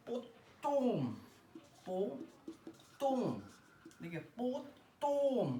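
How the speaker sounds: पोतोम very slow, तो drawn out and pressed; teacher drawing out तो of पोतोम for students; stretched stressed vowel. Shown as a demonstration. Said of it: "तो पे pressure पड़ रहा है".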